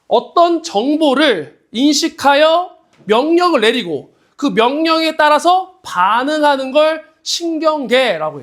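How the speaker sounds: slow, deliberate teacher dictation. Shown as a demonstration. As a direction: spell out the definition slowly. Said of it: "slow definition dictation".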